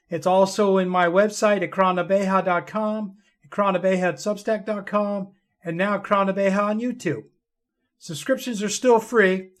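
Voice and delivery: plain reading voice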